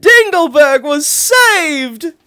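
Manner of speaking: high, loud, slow joyful cry; delighted cry. Acted. Princess crying out as the sun returns, delighted. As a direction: shout it out in delight. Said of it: "cried out in delight".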